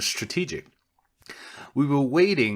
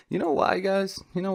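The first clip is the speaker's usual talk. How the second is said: much higher, playful squeak; squeaky teasing question; 10 semitones higher than usual. Acted. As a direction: tease the viewers in a high voice.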